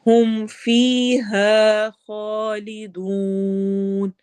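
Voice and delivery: very slow drawn-out chant; Quranic recitation. Shown as a demonstration. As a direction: hold each long vowel as in recitation. Teacher recites Quranic verse.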